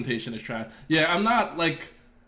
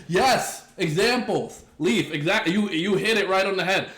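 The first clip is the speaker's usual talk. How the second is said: higher and louder, delighted someone said it; excited agreement; 6 semitones higher, 4 dB louder than usual. Real, not acted. Streamer thrilled that chatter Leaf nailed it, excited. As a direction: jump on the point with glee. Chat makes his exact point.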